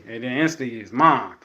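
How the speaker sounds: ordinary talk, nothing marked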